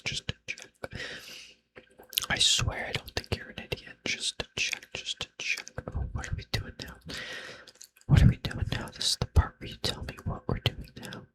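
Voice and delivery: ordinary talk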